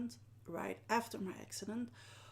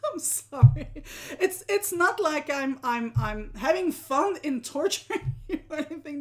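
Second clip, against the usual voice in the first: high, loud, through real laughter; laughing apology; 6 semitones higher, 9 dB louder than usual. Real, not acted. Host apologising for a harder task, laughing. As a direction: giggle while trying to apologise. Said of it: "I'm laughing because I'm like".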